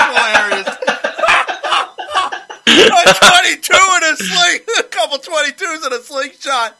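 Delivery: cracking up mid-phrase; laughing. Real, not acted. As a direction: laugh out the words. Co-host cracking up repeating joke.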